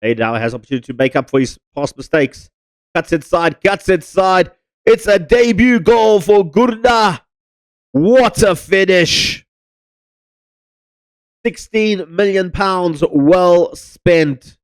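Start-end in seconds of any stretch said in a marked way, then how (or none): 3.0-14.6 excited goal call. Acted. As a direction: roar the goal like a commentator. Goal commentary celebration.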